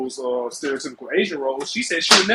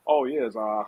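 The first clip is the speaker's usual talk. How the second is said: mock-proper, cleaned-up voice, slightly lower; careful, lower-pitched mock explanation for polite company; 3 semitones lower than usual. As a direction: imitate a rapper politely sanitizing his stage name. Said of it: "he tried to clean it up".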